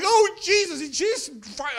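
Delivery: loud, high cry to Jesus; hammed-up frightened wailing; mock panicked yelling. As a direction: yell like a terrified passenger. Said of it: "everybody's yelling".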